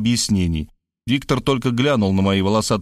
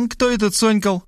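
contemptuous; 11 semitones higher than usual. A narrator's reading. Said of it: "презрительно поинтересовалась Варвара".

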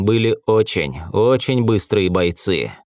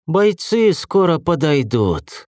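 slow, higher serpent hiss; hissing snake voice; 6 semitones higher, slower than usual. A narrator's reading. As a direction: hiss it out slowly, pitched up. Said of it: "прошипела змея".

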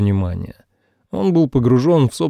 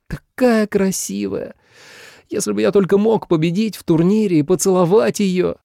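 put-on dreamy boy; 8 semitones higher than usual. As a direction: gush about the princess.

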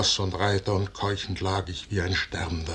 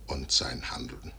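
fading whispered close; whispered final certainty; solemn whisper; 7 semitones lower, 5 dB quieter than usual. Acted. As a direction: sink to a whisper. Solemn end of speech.

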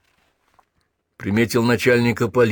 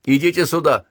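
higher, moderately raised call; 7 semitones higher than usual. A narrator's reading. Sergeant to the two young captives, restrained calling out. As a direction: call them over at raised pitch. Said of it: "Затем крикнул юнгфронтовцам".

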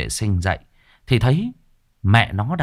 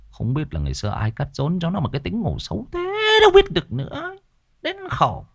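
rising into a high exasperated whine; fussy old man grumbling; high whiny complaint about a lazy sleeper; mock grumble; 10 semitones higher than usual. Narrator grumbling as Cẩn.

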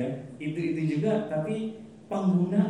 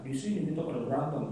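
ordinary delivery

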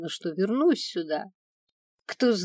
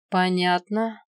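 lightly drawn-out reply; mild drawl; 5 dB louder, slower than usual. A narrator's reading. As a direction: stretch the word out knowingly. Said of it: "протянул ящер".

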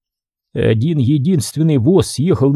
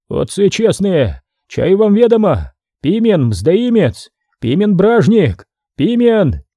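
higher, slow denouncing appeal; accusing; 6 semitones higher than usual. A narrator's reading. Ivan denounces Pimen before the monks, higher and slow. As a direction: slowly denounce him to the fathers.

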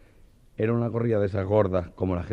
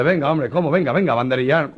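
louder, higher impatient push; urging; 6 semitones higher, 8 dB louder than usual. Retold or imitated. Joselito ordering the Meloja to the bull, loud and impatient. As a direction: shove him toward the bull.

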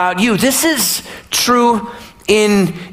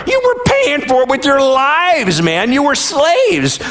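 high-pitched, incredulous correction; exasperated rebuttal; 7 semitones higher than usual. Acted. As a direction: hammer the truth home in mock outrage. Preacher argues back at the complainers.

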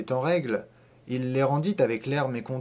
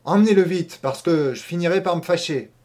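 much louder and higher, losing patience; standing, he nearly shouts; angry order; 4 semitones higher, 6 dB louder than usual. A narrator's reading. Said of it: "Le maire s'était levé et il répéta". (le maire excédé crie l'ordre)

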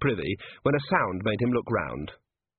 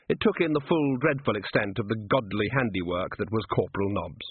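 ordinary delivery

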